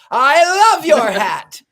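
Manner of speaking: slow, high and loud, gushing; delighted hound dog. Retold or imitated. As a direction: draw it out like a thrilled dog. Hound dog finally loves hat.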